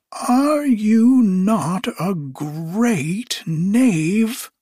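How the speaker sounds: very high, very drawn-out witch's cry; outwitted witch calling after the thief; accusing cry. A narrator's reading. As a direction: stretch every word high in accusation.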